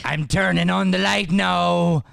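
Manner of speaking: calling out before flicking on the light; loud announcement. Acted. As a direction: announce it loudly to the room.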